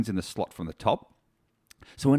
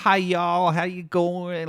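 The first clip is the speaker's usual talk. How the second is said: high, loud mock Southern accent; badly faked Southern drawl, pitched way up; 7 semitones higher, 3 dB louder, much slower than usual. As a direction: put on an exaggerated Southern US greeting. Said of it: "in a very southern accent".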